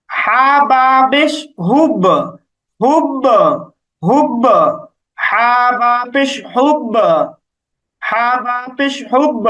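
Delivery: slow spelling, then "হুব" with bounced b; pushed qalqalah. Shown as a demonstration. Teacher spells it out for learners, slow bouncing qalqalah. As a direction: push the final b of "হুব" into an echo. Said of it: "বায়ের উপর আমরা একটা ধাক্কা দিচ্ছি".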